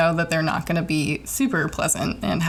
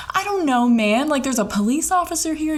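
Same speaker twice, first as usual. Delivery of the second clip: flustered high-pitched; 8 semitones higher than usual. Retold or imitated. Stranded driver to the dispatcher, flustered and high. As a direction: voice jumps high, flustered and helpless. Frantic caller stuck on highway.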